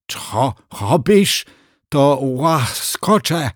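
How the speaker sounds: high, slow lisping crocodile. Acted. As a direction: lisp it like a toothless crocodile. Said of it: "wyseplenił gad".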